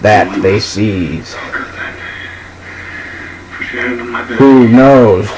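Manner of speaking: slow, drawn-out dramatic poem reading; slow poem recitation. Acted. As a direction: linger on every line like a bard.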